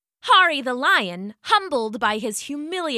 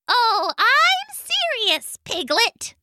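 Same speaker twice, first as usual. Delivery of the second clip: very high, boastful bug voice; bossy gnat voice; 11 semitones higher, slower than usual. Acted. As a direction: strut like a tiny tyrant. Gnat smug.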